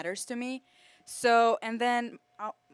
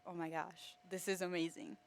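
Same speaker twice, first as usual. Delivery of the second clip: soft, lower aside; retold amazement; 3 semitones lower, 13 dB quieter than usual. Student recalling friends' families including her, quietly amazed. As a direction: quote your own awe quietly. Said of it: "I was just like".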